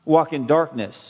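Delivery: usual speaking voice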